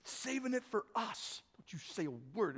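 hushed and conspiratorial, laughing to himself; sly little hush among greedy friends; secretive hush. Acted. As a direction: whisper it like you're hiding the pie from everyone. Stingy crowd hiding their food.

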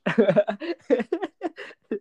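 high, real giggling burst; burst of laughter. Real, not acted. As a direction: laugh it out, high-pitched and loud. Amused at her own turn-off.

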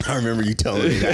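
ordinary talk, nothing marked

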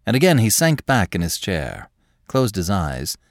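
ordinary delivery

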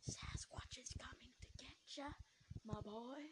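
creepy whispered tease in his ear. Retold or imitated. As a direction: breathe it right into his ear, teasing. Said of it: "I whispered in his ear".

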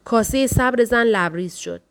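ordinary delivery

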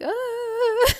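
loud, high mock sob; hammed-up bawling cry; imitated sobbing wail. Showing her embarrassing sobbing at church, mock wailing. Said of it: "embarrassing sobbing".

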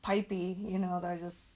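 ordinary talk

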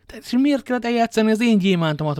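magasabb, hangosabb dühös kitörés; kirobbanó düh. A narrator's reading. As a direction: robbanjon ki belőle a harag. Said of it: "robbant ki D'Artagnan". (dühös kitörés magasabb, hangosabb hangon)

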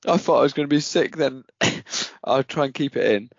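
high, laughing apology; breathless through giggles; laughing while talking. Real, not acted. Laughing fit.

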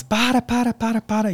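put-on high girlish pleading cry; girls pleading. Girls screaming at the attackers to stop, high pleading. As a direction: mimic frantic girls begging them to stop.

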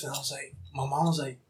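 ordinary delivery

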